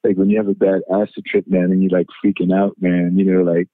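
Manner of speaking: usual speaking voice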